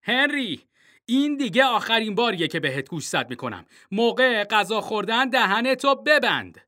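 father yelling across the dinner table; dad shouting. A narrator's reading. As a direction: bellow a final warning. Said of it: "بابا فریاد زد".

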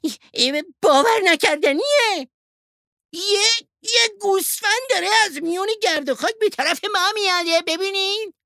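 loud, very high excited yell; astonished shout. A narrator's reading. Young wolf sees a sheep running at them, amazed shout. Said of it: "گرگ جوان با تعجب داد زد".

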